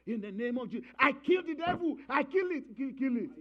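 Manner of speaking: loud, high hammed-up prayer; mock fervent prayer. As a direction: ham up a fiery prayer against the devil. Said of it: "You know".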